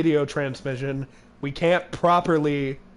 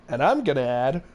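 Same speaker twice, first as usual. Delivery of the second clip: ordinary talk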